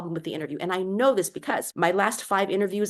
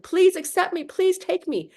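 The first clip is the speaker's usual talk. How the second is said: high, louder, desperate begging; mock pleading; 10 semitones higher, 4 dB louder than usual. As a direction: beg them to take you, half-mocking. Mock begging interviewer for job.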